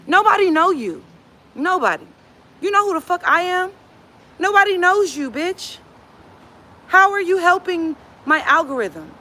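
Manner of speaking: high-pitched, indignant challenge; scornful disbelief. Real, not acted. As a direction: put them down, incredulous and high. Scornfully challenging a live commenter.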